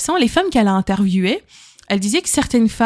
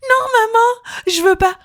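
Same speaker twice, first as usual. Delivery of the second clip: put-on very high, slow whiny child voice; child refusing food, high whine; 12 semitones higher, slower than usual. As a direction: whine like a fussy kid refusing. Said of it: "ils font des caprices".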